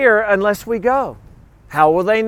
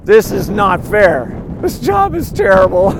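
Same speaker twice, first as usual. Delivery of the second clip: complaining to wife. Retold or imitated. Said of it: "tell my wife".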